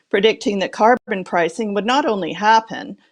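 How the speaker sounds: ordinary delivery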